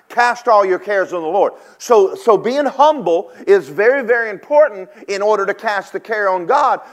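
loud, high preacher's call, rising with fire; full force preaching. Acted. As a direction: preach it out to the congregation.

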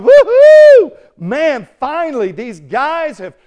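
loud, high celebratory whoop, then high-pitched relief; jubilant onlookers. Acted. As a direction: whoop it up like a cheering crowd. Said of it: "They're going".

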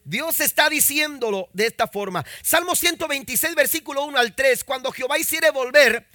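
loud, high, fervent sermon delivery; preaching at full force. Real, not acted. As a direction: preach it to the congregation. (predicación fervorosa, fuerte y aguda)

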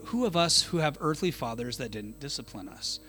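normal talking voice

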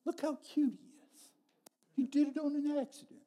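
doting. Retold or imitated. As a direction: coo over him like a proud parent. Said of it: "He just looks at him and says".

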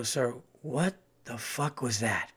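slow, quiet, deadpan challenge; deadpan confrontation. Retold or imitated. Comic confronting the heckler, slow deadpan. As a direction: stop the show dead and stare him down.

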